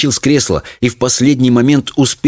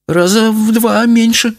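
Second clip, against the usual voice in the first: high, slow voice, reckoning aloud; estimating; 13 semitones higher, slower than usual. A narrator's reading. Mother sizing up her shrunken husband, estimating. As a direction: size him up slowly, high-pitched. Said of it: "прикинула мать".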